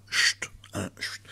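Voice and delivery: whispered shushing; quick, voiceless call for silence; hushing. Pretend. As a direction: hush the audience softly. Hushing the listeners.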